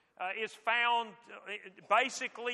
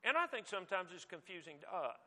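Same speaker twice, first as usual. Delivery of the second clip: ordinary talk, nothing marked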